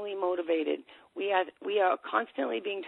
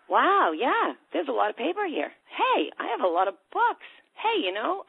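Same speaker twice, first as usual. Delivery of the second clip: higher, louder dawning surprise; mock surprise; 6 semitones higher, 4 dB louder than usual. Listener looking round the room, mock surprised. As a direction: discover the mess, amused. Listener noticing own clutter.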